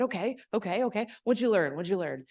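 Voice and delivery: Janet impression. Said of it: "I'll do my best Janet impression".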